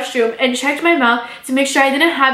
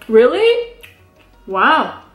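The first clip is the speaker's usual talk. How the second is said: fake interest; much slower than usual. Fake interest in his talk.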